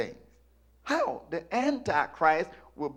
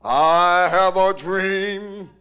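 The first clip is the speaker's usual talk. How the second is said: slow, loud oratory; booming, stretched-out civil rights orator; Martin Luther King; 2 semitones higher, 11 dB louder, much slower than usual. Retold or imitated. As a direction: draw every word out grandly. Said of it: "How you think Martin Luther King warned us?".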